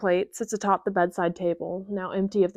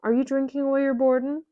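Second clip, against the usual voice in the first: light, quick, higher musing tease; 6 semitones higher, 3 dB louder, faster than usual. Acted. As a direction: tease him lightly, pitched up. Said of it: "she muses".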